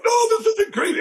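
high, elated squeal of joy; thrilled exclamation. Retold or imitated. Retelling his thrill on the plane to the interviewer, high and elated. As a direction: blurt it out like a prayer just got answered.

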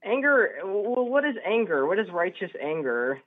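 usual speaking voice

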